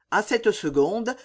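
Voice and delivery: ordinary delivery, nothing marked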